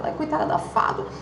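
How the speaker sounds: whispered "poor thing" aside; pitying whisper. Retold or imitated. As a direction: whisper it as a sorry friend. Friends pitying her aside. (sussurro com pena)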